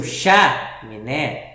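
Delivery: "chaminé" with CHA wrongly punched, rest dropped away; stress on first syllable. Shown as a demonstration. Teacher showing a wrong reading, stressed first syllable. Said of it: "Em vez de ser".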